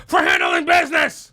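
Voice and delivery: abrupt ranting yell; sudden shout. Acted. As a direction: burst into a loud, high yell. Mock-angry rant bursts out.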